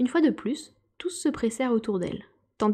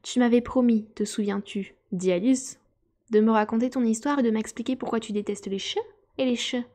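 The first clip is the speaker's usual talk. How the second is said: ordinary delivery, nothing marked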